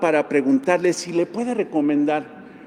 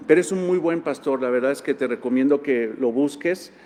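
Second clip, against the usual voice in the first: normal talking voice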